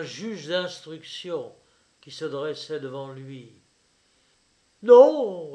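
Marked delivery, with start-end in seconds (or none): 4.8-5.5 loud, high, drawn-out no; forced bluster, much louder and higher; would-be brutal. A narrator's reading. Father answering his questioning son, would-be brutal. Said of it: "avec un accent qu'il voulut rendre brutal et qui ne fut que troublé".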